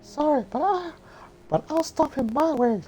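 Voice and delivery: put-on high falsetto girl voice; squeaky high-pitched heroine; Mulan voice.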